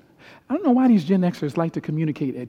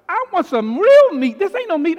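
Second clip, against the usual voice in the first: loud, high angry yell for real meat; yelling son; 13 semitones higher, 12 dB louder than usual. Retold or imitated. Son yelling in the restaurant about vegan food, loud and high. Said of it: "He yelled out loud in the restaurant".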